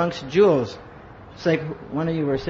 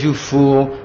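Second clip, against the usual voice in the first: louder, mock-deep guru scolding; deep Hungarian voice; 3 semitones lower, 5 dB louder than usual. Guru rebuking his disciple on the phone, deep Hungarian voice. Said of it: "he's got this deep Hungarian voice".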